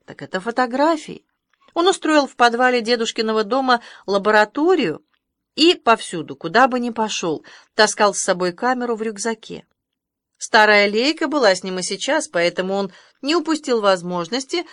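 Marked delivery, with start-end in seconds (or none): none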